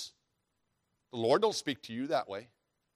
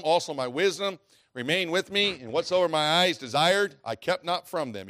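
louder, building reading; scripture reading; 2 semitones higher, 5 dB louder than usual. Acted. Preacher reads Solomon's boasts aloud, building. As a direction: let the verses climb.

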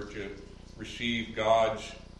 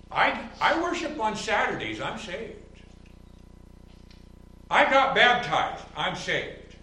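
much higher, louder mimicry, loud on baptized; mock claims; 10 semitones higher, 8 dB louder than usual. Pastor lampooning legalists' claims of being saved, high and loud. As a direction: lampoon the rule-keepers' boasts. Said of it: "things like".

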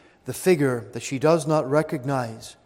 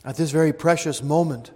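ordinary talk, nothing marked

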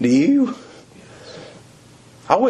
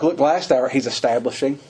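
ordinary delivery

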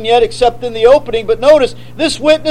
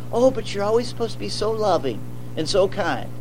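much softer, sneering sing-song mimic; mocking whiny critic; 3 semitones lower, 11 dB quieter, faster than usual. Preacher parodying critics who want kindness, soft mocking whine. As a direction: parody the always-kind crowd.

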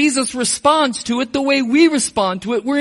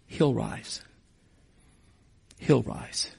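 soft and hushed, much quieter; quiet, tender; 9 semitones lower, 10 dB quieter than usual. Retold or imitated. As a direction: murmur it gently, almost to yourself. Jesus's words quoted at the tomb.

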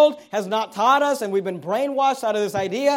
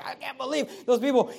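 ordinary talk, nothing marked

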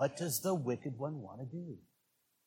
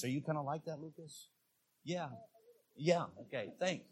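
ordinary talk

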